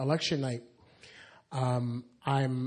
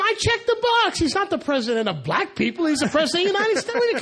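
exasperated retort; 5 dB louder than usual. Retold or imitated. Her exasperated reply to callers.

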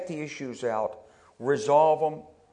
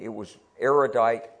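normal talking voice

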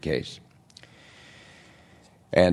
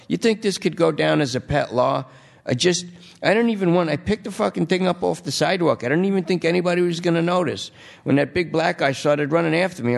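whiny junkie; 9 semitones higher than usual. Acted. Lester protests high and fast.

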